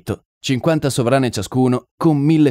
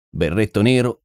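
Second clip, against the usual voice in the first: ordinary delivery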